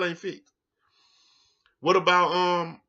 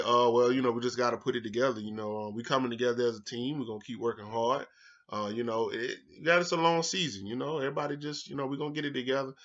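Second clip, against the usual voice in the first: mock bland press answer; 3 semitones lower, 7 dB quieter than usual. Shown as a demonstration. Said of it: "you know what you say? You say".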